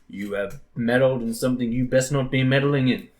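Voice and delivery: playful grandiose menace; mock-menacing threat. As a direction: deliver a theatrical ultimatum to a teammate. Mock threat after being shot back.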